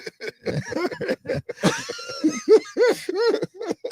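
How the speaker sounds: long high wheezing laugh; laughing hard. Real, not acted. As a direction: crack up helplessly. Cracking up during banter.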